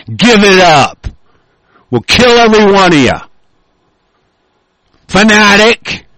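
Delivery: real angry outburst, voice pushed up; furious rant. Real, not acted. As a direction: tear into them, threatening and forceful. Furious outburst at religious opponents.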